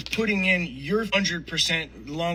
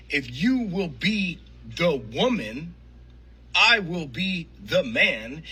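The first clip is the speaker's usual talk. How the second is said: drawn-out, each word laid down slowly; slow and deliberate; slower than usual. Real, not acted. To the women watching, slow deliberate promise. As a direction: spell it out slowly like a vow.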